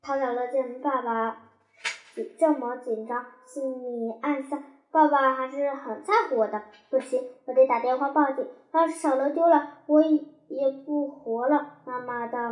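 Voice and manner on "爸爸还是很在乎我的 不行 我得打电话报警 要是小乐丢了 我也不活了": plain reading voice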